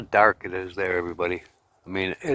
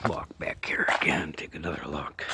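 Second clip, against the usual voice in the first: whispering; 5 semitones lower, 7 dB quieter than usual. Real, not acted. Hushed aside while filming.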